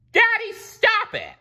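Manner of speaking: high loud plea; cried out. A narrator's reading. Mindy stopping her father, high loud cry. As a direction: stop your father. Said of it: "she cried".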